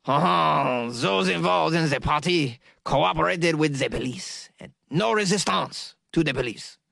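exaggerated French accent, opening on a drawn-out "Oh". Shown as a demonstration. Podcaster retrying the police quote in a hammed-up French accent. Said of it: "Let me try it again".

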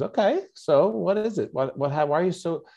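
high, eager questioning mother; curious mom. Retold or imitated. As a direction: mom prying happily. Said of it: "she goes".

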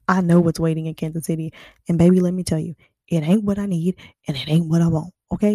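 loud, punchy, sassy declaration; emphatic sass. Real, not acted. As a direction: lay it down loud and sure. Emphatic aside about her hometown.

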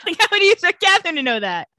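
loud, high, fast laughing retort; laughing protest. Real, not acted. Host defends Catherine to co-host, laughing and incredulous. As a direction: protest loudly through real laughter.

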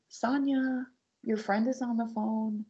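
high, sugary call up the stairs; imitating mom's fake-sweet call; mock sweet singsong; sweet mother voice. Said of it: "And it was real sweet".

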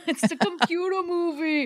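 lightly voiced old man, soft and breathy laugh; a hint of a creaky old man. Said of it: "doing old man voice".

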